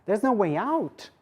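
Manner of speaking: normal talking voice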